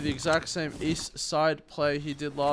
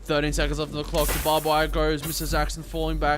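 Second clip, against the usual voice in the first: esports caster; 4 semitones higher, 7 dB louder than usual. Real, not acted. Live play-by-play builds to the kill.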